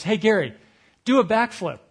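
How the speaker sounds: calling across a room. Retold or imitated. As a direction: shout it across the hall. Host yells across ballroom.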